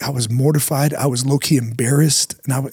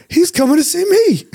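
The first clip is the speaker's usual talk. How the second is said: much higher, squeaky mock alarm; panicked realisation; 3 dB louder than usual. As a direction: play the dawning dread, voice jumping up. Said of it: "I'm like".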